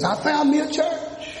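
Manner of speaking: high, pleased mock boast; preacher voices a person bragging to a friend, mock proud; mock proud churchgoer. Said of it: "They didn't say Jesus".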